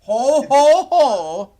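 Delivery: booming jolly Santa ho ho ho; loud hearty Santa Claus laugh. Pretend. Santa beard back on screen.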